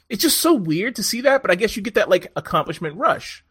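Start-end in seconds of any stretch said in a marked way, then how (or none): none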